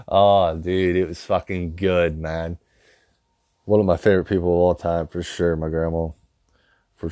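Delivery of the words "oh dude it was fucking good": ordinary delivery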